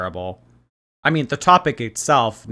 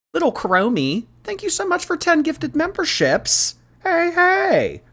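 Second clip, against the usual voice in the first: very high squealing gratitude; delighted high-pitched shout-out; excited thanks. Real, not acted. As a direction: squeal your thanks to a generous fan. Thanks for ten gifted memberships.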